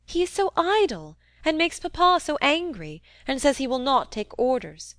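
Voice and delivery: put-on high-pitched complaining young lady; petulant Rosamond figure. Narrator voices petulant Rosamond.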